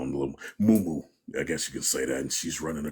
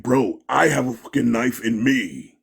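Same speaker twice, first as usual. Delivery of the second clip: retold shouting; 9 semitones higher, 10 dB louder than usual. Retold cop standoff shouting.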